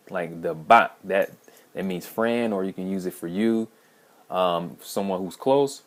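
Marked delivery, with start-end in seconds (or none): 0.5-1.0 "bot" with an open ah vowel, stressed loudly; a loud, clear "bot" with a broad ah; own pronunciation. Shown as a demonstration. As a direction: set "bot" apart, vowel as in father. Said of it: "like, I was saying".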